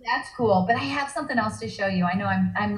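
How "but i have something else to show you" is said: quick, hurried presenter pace; talking fast. Real, not acted. Host moving on to show something else, talking fast. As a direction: rush through it to get to the next item. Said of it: "talking fast and showing you".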